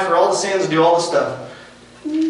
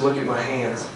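soft, low answer as Jesus; gentle reassurance; 4 semitones lower, 8 dB quieter than usual. Acted. Jesus answering a struggling believer, soft and low. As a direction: comfort quietly, unhurried.

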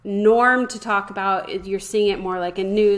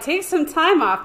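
mocking quote; 8 semitones higher than usual. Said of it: "I don't think most people are like".

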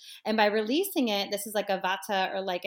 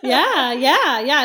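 loud laughing agreement; 4 semitones higher, 14 dB louder, much slower than usual. Real, not acted. Laughs at the no filter joke.

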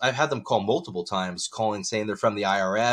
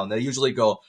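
ordinary delivery

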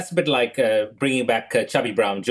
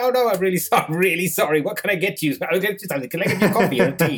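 higher, hurried grovelling with a laugh; doctor grovelling to the offended sister, higher and flustered; flustered apology; 6 semitones higher, faster than usual. Retold or imitated. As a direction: scramble to make amends.

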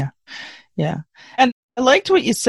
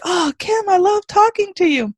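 much higher, gushing fan voice; gushing admirer; 10 semitones higher than usual. Retold or imitated. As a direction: swoon over Kim like a starstruck fan. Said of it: "that's why they're like".

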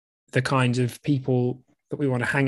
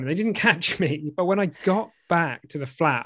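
ordinary talk

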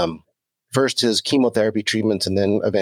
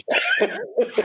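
real, high-pitched burst of laughter. Real, not acted. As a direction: laugh it off after the allergies joke. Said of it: "Yeah, right".

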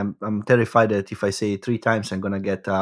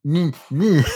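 higher mock stutter on "N-, n-"; stumbling over a name; 5 semitones higher, 3 dB louder than usual. Imitating colleague stumbling when calling the host, mock stutter. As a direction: imitate getting stuck on the first sound. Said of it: "she would stumble when".

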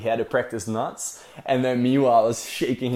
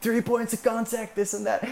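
much higher rapid-fire orders; imitating skipper Glenn; 11 semitones higher than usual. Skipper Glenn sending the boys up the deck, high mock shouting. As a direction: bark the list of orders like the skipper. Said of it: "he was like".

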